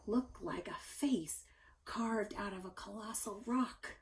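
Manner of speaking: hushed whisper, spooky; mysterious whisper. A narrator's reading. Storyteller whispers the eerie Mars face to kids. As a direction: breathe it like a secret.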